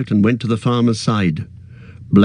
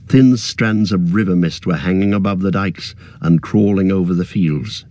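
ordinary delivery, nothing marked

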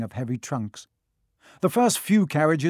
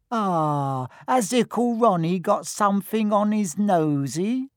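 higher, sing-song teasing coo; mocking baby talk; 7 semitones higher, 3 dB louder, slower than usual. A narrator's reading. Twin mocks Ron in high baby talk. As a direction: tease your little brother like a baby.

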